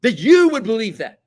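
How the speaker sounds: loud, high, drawn-out indignant yell. Acted. Debater suddenly erupting at a relative over politics, yelling. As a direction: act out a sudden emotional blow-up. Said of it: "I'm sorry if I raised my voice".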